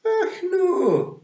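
very high, loud Scots protest; Rob Anybody. A narrator's reading. Rob denying to Tiffany he was in a pub, high Scots protest. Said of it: "said Rob Anybody".

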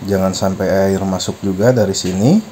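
ordinary delivery

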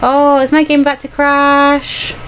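usual speaking voice

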